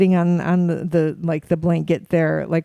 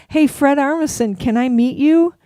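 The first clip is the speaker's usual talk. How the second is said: bright-eyed, higher-pitched approach to a celebrity; retold bold hello, pitched up; eager greeting; 7 semitones higher, 4 dB louder than usual. Parent walking up to a famous musician, eager greeting.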